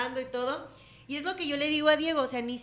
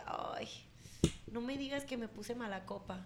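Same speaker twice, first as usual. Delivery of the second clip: sheepish softer question; 9 dB quieter than usual. Retold or imitated.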